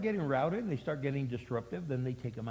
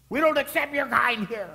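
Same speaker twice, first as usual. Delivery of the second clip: higher, louder sneer; mock snobbish rejection; 9 semitones higher, 8 dB louder than usual. Pastor mocking exclusive churches, louder sneer. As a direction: sneer it like a snob. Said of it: "we didn't say".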